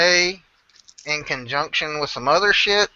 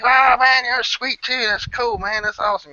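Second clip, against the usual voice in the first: higher, gushing answer; excited reply; 10 semitones higher than usual. Retold or imitated. The biker buddy returning the compliment, higher and gushing. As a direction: play the buddy all thrilled. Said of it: "And he's like".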